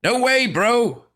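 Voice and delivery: high, loud, slow yell; stubborn boy yell. Acted. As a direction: refuse it loudly. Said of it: "Fancy Pants yelled".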